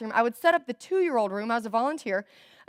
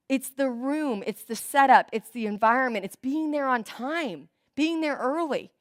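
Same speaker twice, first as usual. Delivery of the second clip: ordinary talk, nothing marked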